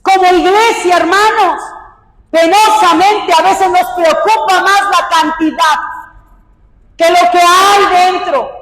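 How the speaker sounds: high, forceful preacher's voice; impassioned preaching. Real, not acted. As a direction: press the point on the congregation. Preaches in raised voice.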